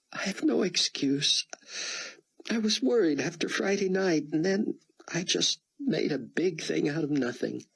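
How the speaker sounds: put-on a tearful young woman owning up; Chloe. A narrator's reading. Chloe confesses to Buck, high and tearful.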